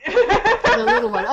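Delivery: laughing it out, slow and high. Real, not acted. Player laughing at the nickname for the boy, laughing. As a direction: chuckle through the words, savouring them. Player amused by the NPC's phrase.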